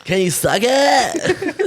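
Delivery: high, drawn-out comic beggar; homeless man voice. Acted. Riffing for laughing friends, high homeless man voice. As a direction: wail it slowly in a put-on voice. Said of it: "I like your homeless voice".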